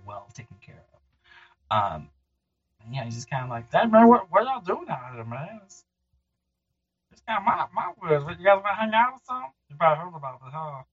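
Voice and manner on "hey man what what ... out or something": higher, chummy drifter voice of a three-legged monster; friendly forest creature. Acted. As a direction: play a laid-back, boastful woods creature.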